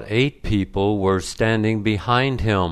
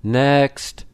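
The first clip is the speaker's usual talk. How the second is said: mildly raised, impatient "next"; impatient call; 5 semitones higher than usual. A narrator's reading. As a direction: call it out with a touch of impatience, pitched up. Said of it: "said loudly and impatiently".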